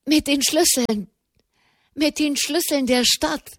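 high, halting, breathless excitement; too agitated to speak. A narrator's reading. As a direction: struggle to get it out, pitched high. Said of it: "dass sie nicht sprechen konnten". (aufgeregt stammelnd, atemlos)

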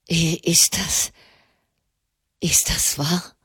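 stammering, shaken question; trembling voice. A narrator's reading. Pope asking his envoy in disbelief, trembling. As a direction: ask as if afraid to believe it. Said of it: "fragte er mit bebender Stimme".

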